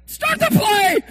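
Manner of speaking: high, loud shout at the clock; mock impatient shout. Preacher plays a fan shouting to start the play. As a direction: demand the snap.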